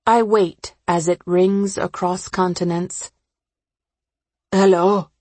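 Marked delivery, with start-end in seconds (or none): 4.5-5.1 a touch of sleepy gravel; lightly voiced just-woken mother; gravelly from sleep. A narrator's reading. Mother answering a late call across continents, mildly gravelly from sleep. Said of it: "My mom's voice is gravelly from sleep".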